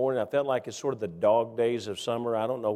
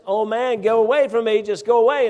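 higher, louder exasperated brush-off; irritated rich man; 8 semitones higher, 6 dB louder than usual. Retold or imitated. Rich driver shooing the old man away, higher and louder in irritation. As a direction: shoo the pest away, fed up. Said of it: "And he says".

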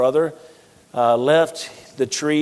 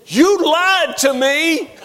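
higher, slow, wounded mom accusing; mother confronting her grown son, mildly mad; 13 semitones higher, 4 dB louder, much slower than usual. Retold or imitated. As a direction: play his upset mother with the pitch up. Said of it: "and she was mad".

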